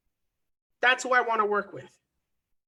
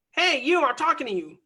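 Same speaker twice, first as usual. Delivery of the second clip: calling out; 4 semitones higher than usual. Retold or imitated. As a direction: shout to grab a stranger's attention. Calling out to stranger in crowd.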